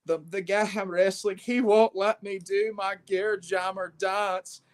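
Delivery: high mock-whine of a crying wrestler; hammed-up tearful complaint; imitating tearful bawling. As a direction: drag it out high, mimicking a sulky cry. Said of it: "he just starts bawling his eyes out".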